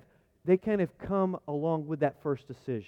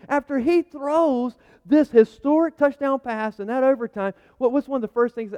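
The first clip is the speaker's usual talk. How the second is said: preaching at full force; 8 semitones higher, 11 dB louder than usual. Acted. Preaching surge.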